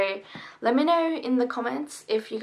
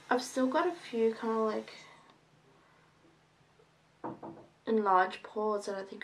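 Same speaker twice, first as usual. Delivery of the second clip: speaking through fingers; fingers touching lips; 4 dB quieter than usual. Real, not acted. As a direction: hand partly over mouth. Seen 1: Fingertips press nose and upper lip while talking, partly covering mouth.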